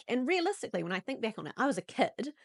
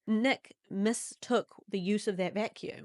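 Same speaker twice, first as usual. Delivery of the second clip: usual speaking voice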